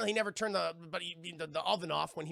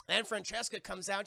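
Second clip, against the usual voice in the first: usual speaking voice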